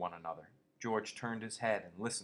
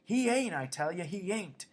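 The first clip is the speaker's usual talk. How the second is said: desperate cry; 10 semitones higher, 6 dB louder than usual. A narrator's reading. Lenny covers ears, protesting.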